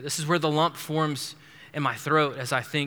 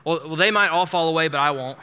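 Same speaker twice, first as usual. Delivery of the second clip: louder, quick cocky boast; overconfident; 2 semitones higher, 8 dB louder, faster than usual. Retold or imitated. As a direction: brush off the warning with swagger. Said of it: "what does Peter say ever so confidently".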